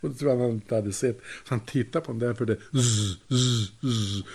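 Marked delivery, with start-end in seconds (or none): 2.6-4.2 buzzing like a fly trap, lightly imitated; a quick mock zzz of a buzzing fly. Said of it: "lät det till den flugfångaren".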